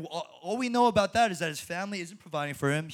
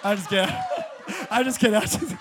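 louder, laughing repeated I'm just kidding; real laughter while taking back a joke; 3 semitones higher, 8 dB louder than usual. Real, not acted. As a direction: giggle through the backpedal.

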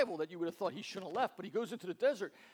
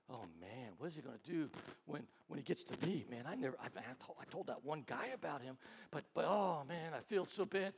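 hushed, anxious self-talk under the breath; a nervous aside, low and soft; worried muttering; 6 semitones lower, 8 dB quieter than usual. Retold or imitated. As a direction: fret quietly to yourself. Worried guy talking during drama.

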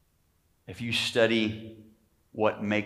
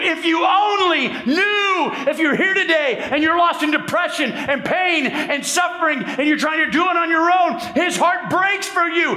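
much higher and louder, slowing on each refrain; shouting preacher; 7 dB louder than usual. Real, not acted. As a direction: drive home each appeal, then stretch the refrain. Preaching at full force, repeated refrain.

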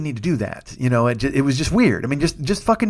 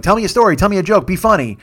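higher, louder pestering imitation; mock demanding; 6 semitones higher, 4 dB louder than usual. Strangers pester the comedian for a joke, mock demanding. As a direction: rattle off demands like a pushy stranger. Mimics people demanding jokes.

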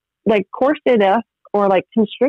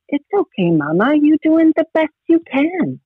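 put-on higher, lilting little girl; child's voice, lightly voiced; 10 semitones higher than usual. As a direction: gently quote your four-year-old. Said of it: "And she said".

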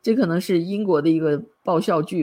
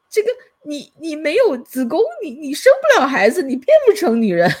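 higher and louder, acting out the exasperation; mock exasperated retort; 10 semitones higher, 5 dB louder than usual. Retelling how the sensible man snaps at Stan, mock exasperated. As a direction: replay the man's frustrated protest with raised pitch. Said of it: "这个人就急了说".

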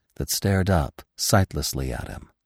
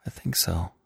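hushed, barely voiced answer; whisper; 6 dB quieter than usual. A narrator's reading. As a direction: answer under your breath. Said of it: "Bambi said in a whisper".

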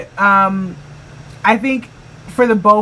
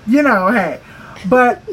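ordinary talk, nothing marked

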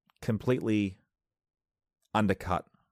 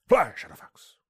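Gandalf impression; 11 semitones higher, 8 dB louder than usual. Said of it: "Saruman's arm would have grown long".

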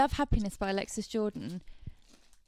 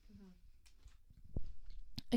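ordinary talk, nothing marked

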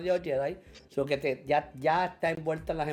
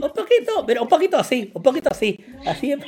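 laughing; 8 semitones higher, 12 dB louder, faster than usual. Real, not acted. Laughing over teasing friend.

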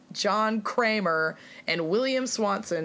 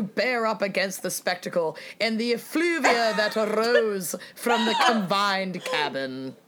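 uppity cartoon British accent, laughing through it; mock posh English newspaper read; a lightly held cartoon British accent; pseudo-British accent; 2 semitones higher than usual. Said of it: "It's uppity pseudo-British".

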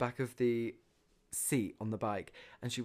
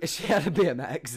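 laughing; 2 semitones higher, 8 dB louder than usual. Real, not acted. Laughing aside.

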